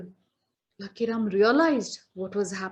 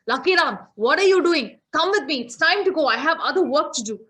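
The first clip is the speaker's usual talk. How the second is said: louder, higher impatient demand; restless, impatient; 4 semitones higher, 6 dB louder, faster than usual. Acted. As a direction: snap at him to hurry up. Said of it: "Yama was getting very restless".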